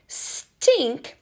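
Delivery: "Stink" said whole, high and bright; blended word. Shown as a demonstration. Teacher to preschoolers, blending the word back together. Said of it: "Let's listen again".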